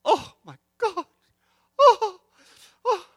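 put-on high, loud squealing old mother; mother's delight. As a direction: squeal with chocolate joy like an elderly mother. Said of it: "She goes".

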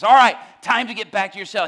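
loud, high guard's command; barking prison guard. Retold or imitated. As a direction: shout an order at an inmate. Prison guard ordering inmate back.

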